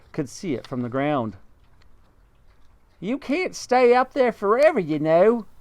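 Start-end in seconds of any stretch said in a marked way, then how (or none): 3.0-5.5 high bird voice; roly-poly bird. Acted. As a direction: chirp it like a friendly bird. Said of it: "the roly-poly bird said".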